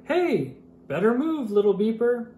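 high loud holler. Acted. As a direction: holler at the little truck.

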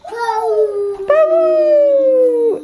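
high, loud elephant trumpet. Retold or imitated. Mom imitates elephant trumpet.

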